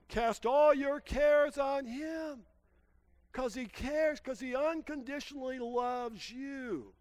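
high, loud, slow proclaiming of the verse; slow emphatic scripture. Acted. Preacher reading the verse to his congregation, slow and loud. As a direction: lean on each word like a promise.

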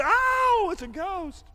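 frightened cry. Retold or imitated. Preacher acting the disciples seeing a ghost, frightened shriek. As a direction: yelp like a spooked disciple, then drop back. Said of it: "All of a sudden they say".

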